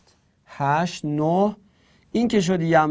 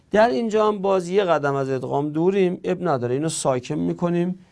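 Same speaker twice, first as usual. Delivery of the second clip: ordinary talk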